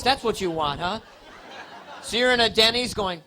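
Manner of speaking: normal talking voice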